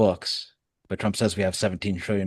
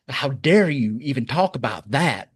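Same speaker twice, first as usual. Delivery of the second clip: high, slower mock Southern accent; put-on Southern indignation; 5 semitones higher, 4 dB louder, slower than usual. Host mimicking an official who dodges questions, indignant Southern accent. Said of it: "Chrisley impersonation".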